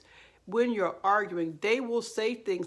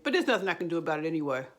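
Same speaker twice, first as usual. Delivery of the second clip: tacked on very fast; immediate rushed add-on; much faster than usual. Acted. Narcissist undercutting their own apology, hurried and dismissive. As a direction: rattle it off as a quick dismissal. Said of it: "immediately add in".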